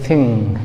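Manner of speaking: थिंग opening on an airy th, not a stop; native th sound. Shown as a demonstration. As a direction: say थिंग with tongue between teeth, air flowing out. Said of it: "वो लोग बोलते हैं".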